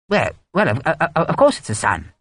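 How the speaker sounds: flustered, stumbling colonel; stammer, caught off guard; confused stammer. A narrator's reading. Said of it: "Runciman said, confused".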